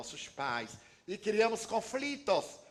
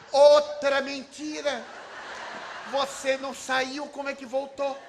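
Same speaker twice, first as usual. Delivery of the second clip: put-on cheeky child; 9 semitones higher, 10 dB louder than usual. Little Julinho to uncle Divaldo, high cheeky child accusation. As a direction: play the boy catching an adult in a lie.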